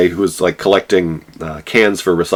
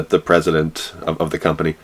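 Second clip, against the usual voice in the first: normal talking voice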